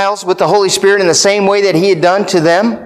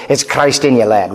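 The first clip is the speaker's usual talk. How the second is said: Scottish brogue; 7 semitones lower than usual. Retold or imitated. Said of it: "in that Scottish brogue".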